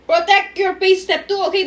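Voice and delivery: ordinary talk, nothing marked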